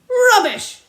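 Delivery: high, loud yelled rubbish. A narrator's reading. Landowner yelling down the father's story. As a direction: explode with the word. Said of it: "yelled Mister Hazel".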